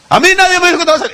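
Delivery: high, fast whiny complaint; mock indignant protest. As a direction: rattle it off like an offended churchgoer. Voices another listener's protest. (queja ofendida, aguda y rápida, en broma)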